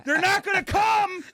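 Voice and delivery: high, loud, whiny complaint; laugh-shout at the interrupting mother-in-law; exasperated shout. Retold or imitated. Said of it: "And I was like".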